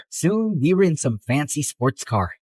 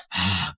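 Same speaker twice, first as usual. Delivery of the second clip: weary sigh; 9 semitones lower, 6 dB quieter than usual. Acted. Exasperated sigh.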